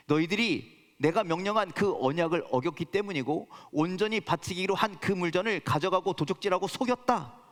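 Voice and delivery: normal talking voice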